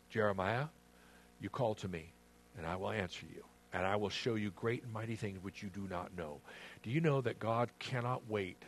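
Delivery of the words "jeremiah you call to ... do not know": soft, lowered voice of God; quiet, low, calming promise; gentle reassurance. Acted. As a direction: speak tenderly, as God to a troubled prophet. Voicing God's answer to Jeremiah.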